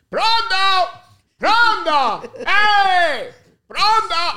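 very high, loud shouting; imitated phone bellow. Parent answering Saturday call from Italy, imitated shouting. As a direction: bellow a phone greeting through the house. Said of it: "We'd hear him or my mom pick".

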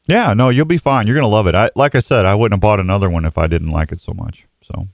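normal talking voice

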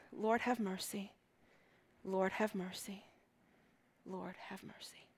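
slow, dropping to a whisper; fading prayer. Shown as a demonstration. Preacher echoing the martyr's prayer, fading into a whisper. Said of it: "it literally means".

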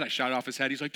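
ordinary delivery

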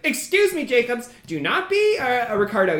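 high-pitched, prim little goblin. Acted. Goblin turns to scold his coworker, high prim voice. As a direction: rebuke a colleague in a high little voice. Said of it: "and is like".

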